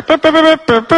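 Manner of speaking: high, loud trumpet-call imitation; brassy mouth fanfare; mock fanfare. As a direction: toot it out like a bugle. Mimicking bugle fanfare.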